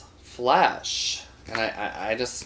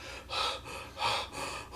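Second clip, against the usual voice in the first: heavy sigh; 11 dB quieter than usual. Retold or imitated. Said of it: "He says".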